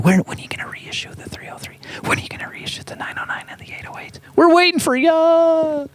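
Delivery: hushed, breathy plea to a synth company; conspiratorial whispered appeal; pleading whisper. Pretend. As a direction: whisper it like a secret begging.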